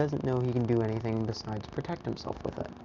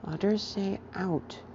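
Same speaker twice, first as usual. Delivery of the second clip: put-on toddler voice; 7 semitones higher, 3 dB quieter than usual.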